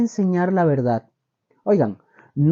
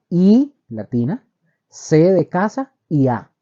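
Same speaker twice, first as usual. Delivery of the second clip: letter by letter, each letter set apart; spelling it out. Shown as a demonstration. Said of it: "les voy a deletrear la palabra homilética".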